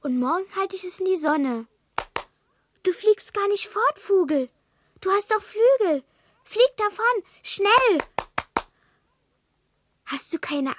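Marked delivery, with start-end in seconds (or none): 2.8-8.0 urgent urging. Acted. Child urging swallow to flee.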